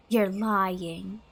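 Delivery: ordinary delivery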